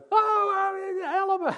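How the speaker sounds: high, loud "help me" as the painter cried out; retold cry for help. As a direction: yell it like someone with chemicals in his eye. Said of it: "En hij begon te schreeuwen". (luide hoge noodkreet van de schilder)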